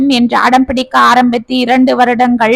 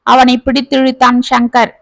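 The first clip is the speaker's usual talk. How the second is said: ordinary delivery, nothing marked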